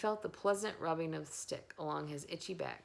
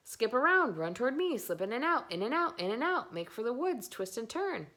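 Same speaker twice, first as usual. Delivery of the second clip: goose orders; 6 semitones higher, 5 dB louder than usual. A narrator's reading.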